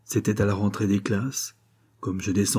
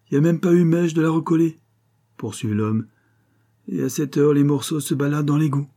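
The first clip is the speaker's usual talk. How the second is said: brocanteur bourru; 6 semitones higher, 3 dB louder than usual. Acted. Brocanteur continue.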